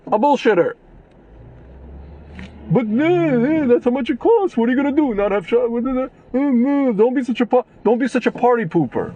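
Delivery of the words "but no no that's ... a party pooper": high mocking whine of a smug seller; much higher mock-innocent voice brushing off complaints; mocking the overchargers. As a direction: sneer it back in a much higher, sing-song voice. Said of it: "No, they'll be like".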